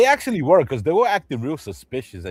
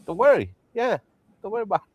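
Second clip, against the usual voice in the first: higher, louder mock reassurance; higher, louder hammed-up gangster reassuring; 10 semitones higher, 5 dB louder, faster than usual. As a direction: wave it off loudly.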